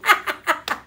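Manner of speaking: high, loud burst of laughter. Real, not acted. Laughing at her own disbelief, loud laugh.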